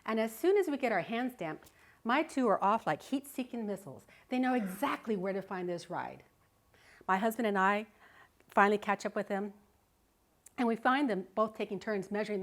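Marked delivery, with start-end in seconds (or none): none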